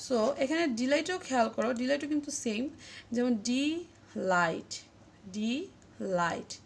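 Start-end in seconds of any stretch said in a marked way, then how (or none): 3.4-4.8 ডি light slowly, in two separate syllables; syllables split for stress. Shown as a demonstration. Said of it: "লাইটে কিন্তু জোরটা বেশি পড়ছে". 5.3-6.6 very slow ডি, pause, then light; ডি light drawn out in two parts; syllables split for stress. Shown as a demonstration. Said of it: "লাইটে কিন্তু জোরটা বেশি পড়ছে".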